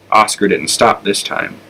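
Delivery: ordinary delivery, nothing marked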